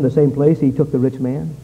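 normal talking voice

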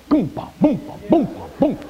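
high imitated car-speaker thump; mock bass beat. Preacher mimicking car stereo bass for the congregation. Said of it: "all you can hear is".

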